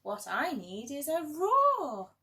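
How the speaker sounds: a small mouse declaring his big plan; determined mouse. A narrator's reading. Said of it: "he said".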